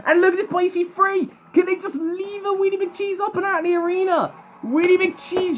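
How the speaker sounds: high-pitched, excited play-by-play; excited commentary. Real, not acted. Commentator calling a robot lift toward the arena exit, excited. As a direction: call it high as the action peaks. Live commentary on a flip attempt.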